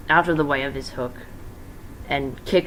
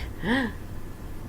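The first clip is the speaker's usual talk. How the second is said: sharp gasp; 8 semitones higher, 8 dB quieter than usual. Acted. Stabbed with knife.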